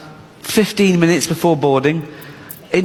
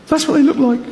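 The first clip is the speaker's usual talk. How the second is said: paranoid inner voice; 10 semitones higher than usual. Retold or imitated.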